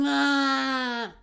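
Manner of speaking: high, loud, long-held holler; drawn-out high kiddie wail; child's yell for mom. Retold or imitated. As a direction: scream for Ma like a little kid. Child screaming for his mother.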